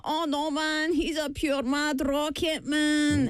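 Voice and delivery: mock a Glasgow street lad in a Scottish accent; Glasgow ned accent. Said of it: "if you were doing a ned".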